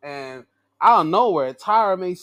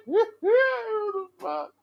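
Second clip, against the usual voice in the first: high, slow words pushed out through laughter; cracking up in disbelief at the promo; laughing disbelief; much slower than usual. Real, not acted. Vlogger reacting to Cutler's promo, laughing in disbelief.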